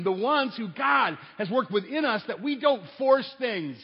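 building sermon climax; impassioned preaching. Real, not acted. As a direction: preach it with mounting force, driving each phrase home. Preaches at full force.

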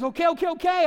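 loud, high, hurried surrender; giving in. Retold or imitated. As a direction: throw up your hands and cave. Guy gives in to pastor.

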